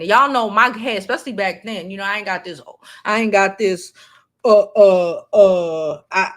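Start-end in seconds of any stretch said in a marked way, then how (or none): 4.4-6.3 loud, halting stammer; stumbling over repeated filler sounds; stuttering. Real, not acted. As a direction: get stuck hunting for the word. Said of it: "stuttering brain I got now".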